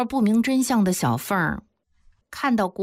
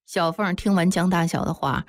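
ordinary delivery, nothing marked

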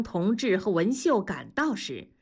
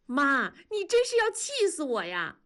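higher, exasperated cry; anxious cry; 7 semitones higher than usual. A narrator's reading. Wenli to her mother-in-law, exasperated high cry. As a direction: cry out at her, at the end of her rope. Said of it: "文丽急了地喊".

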